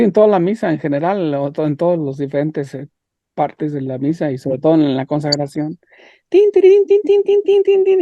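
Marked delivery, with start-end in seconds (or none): 6.3-8.0 high, loud "tin-tin" ringing on one pitch; imitated phone ringtone. Classmate showing phones interrupting the consecration, high ringing imitation. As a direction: mimic a phone going off, piercing and insistent.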